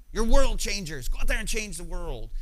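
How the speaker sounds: a hint of a conference pep talk; youth conference speaker. Conference speaker rallying a youth group, mildly imitated hype. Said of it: "they would say things like".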